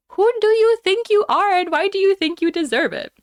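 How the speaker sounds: high, loud furious comeback; angry retort. Retold or imitated. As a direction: blast the line at him, outraged. Retelling furious reply to harasser.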